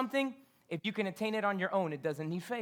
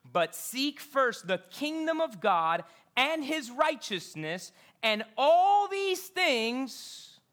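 very slow, higher, louder on all; preaching scripture; 5 semitones higher, 5 dB louder, much slower than usual. Acted. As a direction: draw out and all these things. Reading famous verse.

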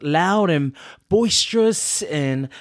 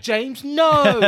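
high, prim scolding mum; a hint of a proper mum telling off; lightly voiced proper mum saying no; mum imitation; 9 semitones higher than usual. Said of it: "She's like".

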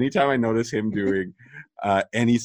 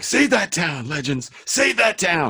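much higher hammed-up rallying cry; mock heroic cheer; 10 semitones higher, slower than usual. Fan cheering the legends to save the town, high mock cheer. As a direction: cheer them on like a cartoon hero. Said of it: "I'm like".

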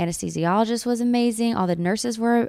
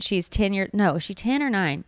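usual speaking voice

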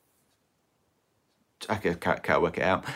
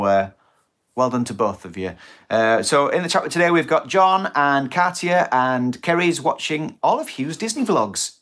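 usual speaking voice

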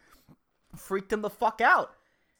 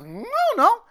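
high, pouty mock refusal; pouting no; 12 semitones higher, 5 dB louder than usual. Comic imitating a pouting woman, high pouty no. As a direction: push the lip out and whine no. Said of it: "bottom lip just overextends".

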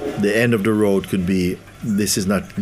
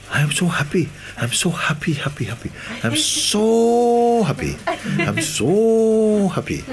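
imitating the sadhu, much higher, slow and sing-song on so happy; sadhu's joy; 13 semitones higher than usual. Sadhu telling Murji how happy he is, high and slow. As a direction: stretch out so happy like a beaming old man.

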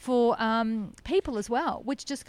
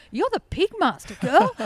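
higher, louder admiring exclamation; mock amazed praise; 8 semitones higher, 8 dB louder than usual. Impressed visitor to pig owner, mock amazed. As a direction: imitate an impressed onlooker, punching it up. Journalist and actor praise her, acted.

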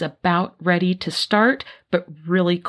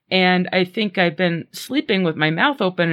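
usual speaking voice

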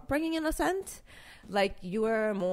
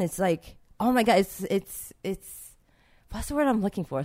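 normal talking voice

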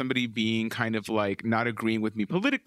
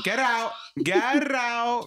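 mock kicked out; 9 semitones higher, much slower than usual. Joking banishment of friend.

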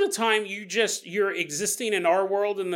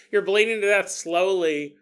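ordinary delivery

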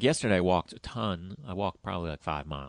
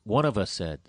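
normal talking voice

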